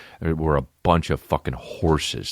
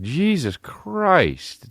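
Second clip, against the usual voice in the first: drawn-out exasperation; 14 semitones higher, much slower than usual. Retold or imitated. Retold exasperated reaction.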